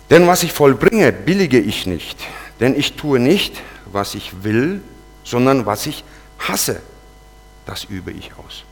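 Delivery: slow, weighty Bible reading; scripture reading. Acted. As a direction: read the verse slowly, every word weighing on you. Reads Romans verses aloud. (langsames, schweres Bibelvorlesen)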